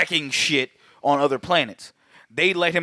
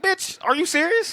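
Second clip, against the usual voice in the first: much higher squeal of disbelief; high mock outrage. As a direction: shriek it incredulous.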